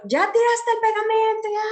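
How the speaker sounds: high, loud exasperated scolding yell; yelling teacher. Shown as a demonstration. Teacher yelling at a child who spilled glue, exasperated shout. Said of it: "estoy a los gritos".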